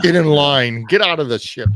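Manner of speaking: loud, curt staff orders; bossy ride staff. Retold or imitated. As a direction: bark orders at guests. Imitating rude ride staff.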